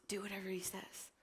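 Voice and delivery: soft whisper; breathy, hushed instruction; whispered quote. Retold or imitated. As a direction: hush it to the servants. Mary's quiet reply, retold.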